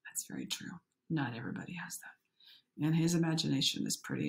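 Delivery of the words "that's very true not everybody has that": quick hushed agreement; soft whisper. Real, not acted. Narrator whispering agreement to the viewers. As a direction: whisper it sincerely.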